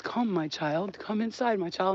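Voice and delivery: a hint of a false saviour coaxing; mock luring voice. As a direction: lure them in gently. False Jesus luring at portal.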